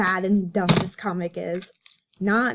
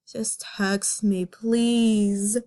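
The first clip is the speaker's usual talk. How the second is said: ordinary delivery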